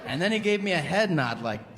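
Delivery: normal talking voice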